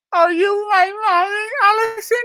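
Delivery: a touch of whimpering, calling out; plaintive crying call. Acted. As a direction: call out like a lost child. Lost puppet crying for its mother.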